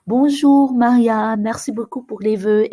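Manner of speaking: ordinary delivery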